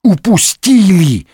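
high, drawn-out wail of dismay; anguished moan. A narrator's reading. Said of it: "простонал Д'Артаньян". (стонущий, протяжный возглас отчаяния)

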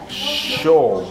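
SHO with a lingering hush and long o; soft, extended sh. Shown as a demonstration. Teacher showing the delicate circumflex S, lingering sho. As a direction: take your time on the sh. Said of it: "Se toma su tiempo".

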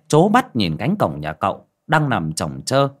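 usual speaking voice

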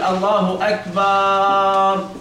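"أكبر" stretched to "akbaar"; slow chant of "الله أكبر" with a long aa after the ب; added alif error. Shown as a demonstration. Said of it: "هذه الألف أيضًا خطأ".

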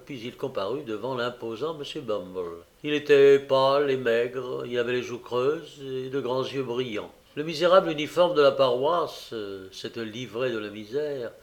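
ordinary delivery

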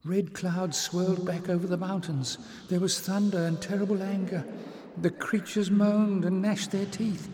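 put-on high young voice, eager; high girlish voice. Little sister telling Jasmine of the red clouds. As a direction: tell it like an awed child.

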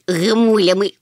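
loud, cheeky one-word jab; snorting quip. A narrator's reading. Chmykh teasing the scholar Hemul, snorting. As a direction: blurt it out mockingly. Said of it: "чмихнув Чмих".

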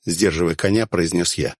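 plain reading voice

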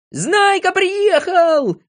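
very high, stretched-out whoop; loud, slow, jubilant scream; excited yell. A narrator's reading. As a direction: yell the news to everyone. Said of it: "завопил Растеряйка".

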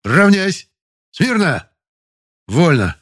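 very high, loud parade commands; drill commands. Acted. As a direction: bark the drill sequence. Лейтенант командует взводом. (громкие строевые команды)

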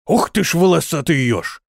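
gasping in wonder; amazed exclamation. Acted. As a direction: blurt it out in awe. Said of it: "воскликнул он".

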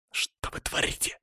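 hissed in a whisper. A narrator's reading. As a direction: hiss it through the teeth. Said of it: "прошипел я". (прошипел шёпотом)

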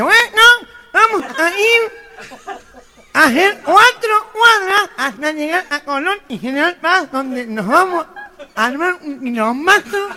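arenga con gangosidad imitada; presidente gangoso. Acted. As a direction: habla nasal, como líder de los gangosos. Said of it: "el presidente de la Asociación Protectora". (presidente gangoso arengando)

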